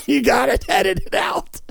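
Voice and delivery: high, laughing through the words; real laughter. Real, not acted. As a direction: plead while cracking up. Laughing at own bit.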